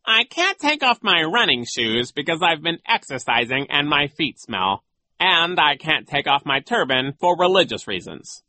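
high, emphatic character voice; suddenly serious. A narrator's reading. Genghis excuses his shoes and turban, serious tone. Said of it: "tone of voice that was suddenly serious".

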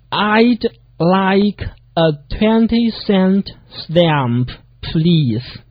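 very slow, word-by-word model; slow practice reading. Shown as a demonstration. Teacher leads learners through the sentence, very slowly. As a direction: read slowly, each word held out. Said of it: "一定要慢".

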